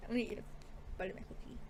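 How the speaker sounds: soft close-mic murmur; ASMR murmur. Real, not acted. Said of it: "That was the A S M R part of the video".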